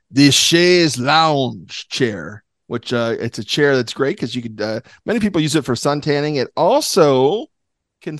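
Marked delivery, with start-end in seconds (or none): 0.1-2.4 chaise lounge said slowly in a mock French accent. Shown as a demonstration. Podcast host announces his best French accent, then says it slowly in French. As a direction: ham up a French accent on the name. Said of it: "I'll use my best French accent".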